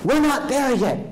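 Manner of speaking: we're not there yet, pitched high and pressed; emphatic point. Shown as a demonstration. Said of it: "In other words".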